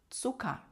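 Цука with the final R softened to an open "a"; German Zucker, ending in "a"; vocalized final R. Shown as a demonstration. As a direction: end Цука on a vowel, no R. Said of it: "Р в кінці ми не читаємо як Р".

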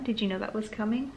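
ordinary delivery

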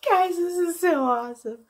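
overwhelmed crying to her viewers. Real, not acted. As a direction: speak through real happy tears. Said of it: "All right, I'm gonna cry".

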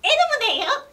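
high, loud puppet voice; Elmo impression. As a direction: squeak it out like Elmo, half failing. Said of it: "やっちゃおう". (甲高く大声のエルモのモノマネ)

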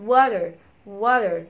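"water" in American accent, drawn out as "WA-der"; slow American "water" with d for t and final r. Shown as a demonstration. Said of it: "لا اما حرف الدي".

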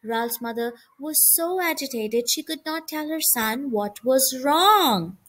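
plain reading voice

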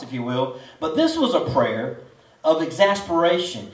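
ordinary talk, nothing marked

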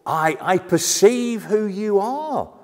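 slow, high, loud perceiving; demon's cry of recognition. Acted. Demon perceiving who Jesus is, drawn-out loud cry. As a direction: stretch it out with dawning certainty. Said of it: "but it's ginosko".

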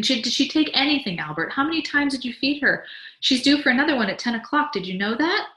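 high, insistent fussing; anxious questioning. Acted. Wife checks on baby's feeds, anxious questioning. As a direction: press him with worried, high questions.

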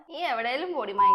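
loud curse thrown at her; angry shout. Acted. Husband cursing his wife as she leaves, shouting. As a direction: bark her away in anger.

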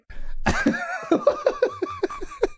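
high, wheezing laugh fit; helpless laughter. Real, not acted. Streamer amused by own gospel song, helpless laughter. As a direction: crack up completely.

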